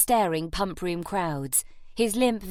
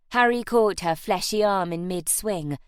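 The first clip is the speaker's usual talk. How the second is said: plain reading voice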